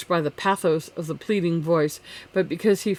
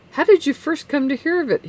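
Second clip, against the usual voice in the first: carefully adjusted tone; 10 semitones higher, 4 dB louder than usual. A narrator's reading. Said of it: "in a tone he tried to render appropriate".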